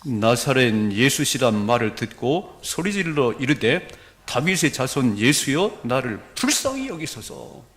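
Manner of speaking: slow, measured reading aloud; scripture reading. Real, not acted. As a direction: lead a congregation reading in unison, drawn out. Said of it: "사십칠 절 시작". (성경을 또박또박 천천히 낭독)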